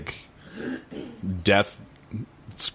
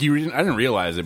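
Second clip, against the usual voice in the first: usual speaking voice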